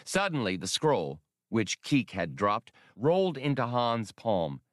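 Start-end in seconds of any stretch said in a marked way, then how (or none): none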